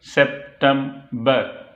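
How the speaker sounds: September broken slowly into सेप, टेम, बर; slow three-part September for a learner; split into syllables. Shown as a demonstration. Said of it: "में तीन syllable हैं".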